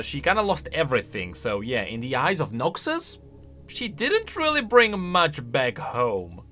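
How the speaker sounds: normal talking voice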